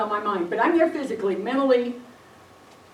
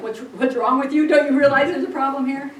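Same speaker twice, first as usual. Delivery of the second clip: mild mock panic; 3 semitones higher, 8 dB louder than usual. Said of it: "he's sort of panicking".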